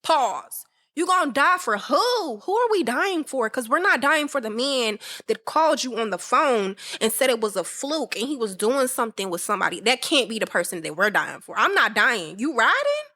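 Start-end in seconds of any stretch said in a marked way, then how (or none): 0.9-3.5 higher, disbelieving questions; incredulous. Real, not acted. Host arguing with the singer, incredulous. 12.4-13.0 high disbelieving question; stunned, challenging ask; incredulous. Real, not acted. Host pushing back on lyrics, high disbelief.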